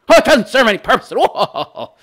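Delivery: high, laughing mockery; mock the idea with a laugh; sarcastic. Real, not acted. Mocking the critic's claim.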